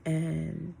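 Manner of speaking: ordinary talk, nothing marked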